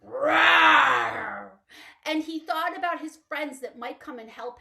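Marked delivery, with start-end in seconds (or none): 0.0-1.6 big loud roaring lion. Acted. Lion calling for help, a huge roar. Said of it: "he roared his loudest roar".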